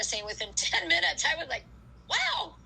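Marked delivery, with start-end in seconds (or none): 2.1-2.6 high, stretched wow of sudden wide-awake surprise; high-pitched retold wow; amazed wow. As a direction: let the caffeine hit you all at once. Caffeine kicking in, retold.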